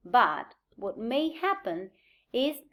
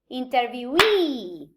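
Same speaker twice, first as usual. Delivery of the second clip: "interviewee" with a long, strong final EE; stress on the suffix; slower than usual. Shown as a demonstration. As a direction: slowly land on the end of "interviewee". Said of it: "moves to the location of the suffix".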